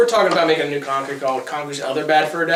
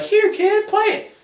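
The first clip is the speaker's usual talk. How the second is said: much higher, light mock-cheerful parent; slower than usual. Parent handing the game to the kid, in a high chirpy voice. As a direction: hand the game over in a high sweet voice. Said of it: "they'd get home and be like".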